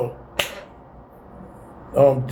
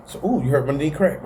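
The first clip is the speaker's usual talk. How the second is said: ordinary talk, nothing marked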